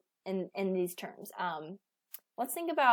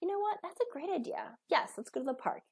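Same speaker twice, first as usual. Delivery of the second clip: high, bright agreement; cheerful yes; 8 semitones higher, 4 dB quieter than usual. Retold or imitated. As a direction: say yes like you can't wait. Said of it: "And you go".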